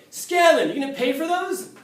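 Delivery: high, loud call across a hallway; a teacher yelling a name down the hall, then teasing. Retold or imitated. The art teacher catching him in the hallway, calling loud and high.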